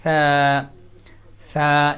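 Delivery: light thin ثا with no whistle; ثا said light and soft, never like سا; soft thin tha. Shown as a demonstration. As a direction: say ثا soft, no hissing. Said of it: "soft ہے اور باریک ہے".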